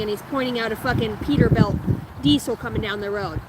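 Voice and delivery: ordinary delivery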